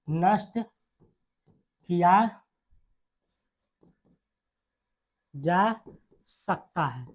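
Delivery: drawn-out words with long pauses; dictation. Real, not acted. Teacher dictating the rule to students, slow dictation.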